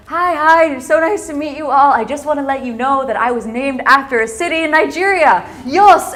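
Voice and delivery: loud, high, eager hello to a crowd; gushing greeting. Retold or imitated. Speaker winning over five hundred Nigerians, gushing greeting.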